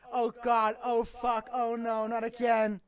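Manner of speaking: high, hammed-up horror; groaning in mock dismay; mock dread. Dreading the scene.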